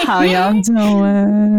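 drawn-out hello to listeners; slow greeting. Acted. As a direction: stretch the greeting out slowly.

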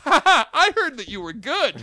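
loud, high-pitched jeering through laughter; mocking laughter. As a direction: laugh it straight in his face. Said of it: "just laughs straight at me".

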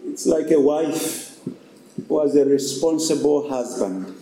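ordinary talk

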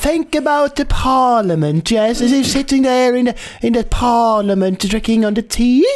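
high, slow toff voice; over-the-top British English; mock posh British. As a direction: ham up an English gentleman. Posh parody continues.

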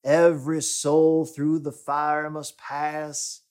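put-on a light Southern drawl for an old pastor, slow on the rhyme; lightly voiced old Southern pastor, fading into soft amens; quoting his mentor's rhyme in a hint of Southern accent; Southern preacher voice. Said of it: "his old Southern voice justice".

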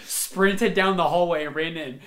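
usual speaking voice

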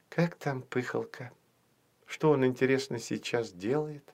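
soft, wistful wondering; dreamy. A narrator's reading. Olya wonders about her absent dragon friend, dreamy and soft. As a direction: murmur it as if lost in a daydream. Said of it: "мечтательно проговорила Оля".

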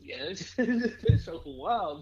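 high, slowed by laughter; real amused laugh breaking up the line. Real, not acted. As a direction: laugh through the words. Amused by the wild show.

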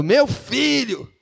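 a hint of a grieving shout, slow and high; mild mock cry. Interpreter echoes King David's cry, lightly voiced. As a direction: stretch out Meu filho like a cry. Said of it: "ele tava gritando".

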